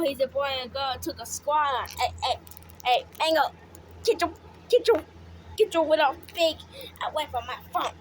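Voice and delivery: ordinary delivery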